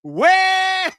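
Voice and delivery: long, high, loud whoop, stretched out; celebratory holler. Real, not acted. Streamer hailing a super chat donor, celebratory holler. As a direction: belt it out, holding the first syllable.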